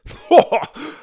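high, loud burst of laughter. Acted. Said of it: "Tim burst into laughter".

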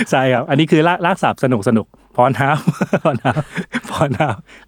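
slow laughing repeat of พรน้ำ. Real, not acted. Host laughing at his own pun while cohost listens. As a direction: chuckle through the words, drawn out. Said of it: "อันนี้สนุก".

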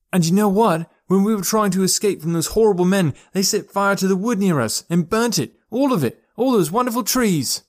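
high, loud, outraged storytelling; impassioned appeal. A narrator's reading. Boy rousing the tree people.

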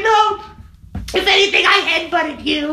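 very high, insistent mock protest; hammed-up high voice, stretching the end; Fran Drescher. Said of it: "do her voice as well".

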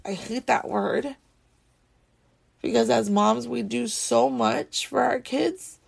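holding back tears. Real, not acted. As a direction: keep the crying voice in check. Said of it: "trying so hard not to have my ugly crying voice".

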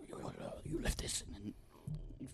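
slow, breathy whisper of instructions; whispered explanation. Retold or imitated. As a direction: hush it out slowly, like a patient hint. Worker explaining, voiced.

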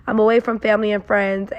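ordinary talk

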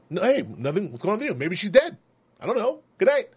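high, rising flustered denials; nervous bad liar. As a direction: babble guilty excuses in panic. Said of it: "the worst liar".

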